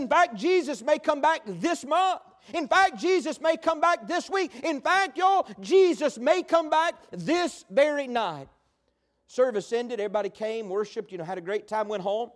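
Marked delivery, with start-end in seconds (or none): 0.0-8.5 raised-pitch preaching, slowing on the last promise; revival preacher. Retold or imitated. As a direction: imitate a preacher building urgency, higher pitch. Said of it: "the preacher started preaching on Jesus coming back".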